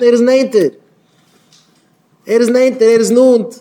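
slow chant-like repetition building to a high shout; impassioned preaching. Real, not acted. Preaching builds on nearing God. (ברענענדע דרשה, העכער און העכער)

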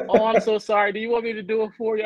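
high, fussing mock apology; apologetic woman imitated. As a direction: imitate her offering help, pitched well up.

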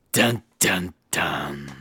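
low, hushed suspense-music imitation; dramatic sting. Storyteller capping the reveal, mock-ominous. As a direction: intone three deep, quiet notes.

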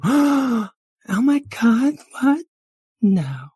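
very high, loud, drawn-out shock; high-pitched scandalized girl; gushing valley girl, shocked. Acted. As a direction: gasp it out in a squeaky girly voice.

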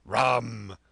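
loud, forceful one-word cry; insistent demand. A narrator's reading. Wounded captain repeats his order to Jim, loudly insistent. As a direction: demand it at once. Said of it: "he repeated".

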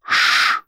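unvoiced hush; quick hissed shush; sharp shush. Shushing from behind.

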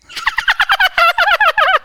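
high shrieking witch cackle. Shown as a demonstration. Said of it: "I used to do my witch laugh".

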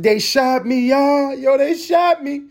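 put-on high, loud panicked girl voice; mock shot girl. Vlogger imitating a girl shot at a gas station, high and loud. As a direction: squeal it like the girl who got shot. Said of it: "And she's like".

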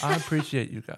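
thanks with a touch of sarcasm and a laugh; amused, insincere-sounding appreciation; sarcastic-sounding thanks. Real, not acted. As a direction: sound as if you don't quite mean the thanks. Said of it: "He said that so sarcastically".

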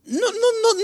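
very high-pitched protest; Cristo. Retold or imitated. Christ scolds the doubter, high-pitched protest. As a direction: jump up high in mock protest. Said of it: "Lo que le estaba diciendo Cristo era".

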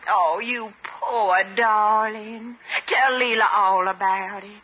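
cooing. Acted. Cooing comfort.

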